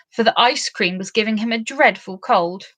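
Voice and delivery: ordinary delivery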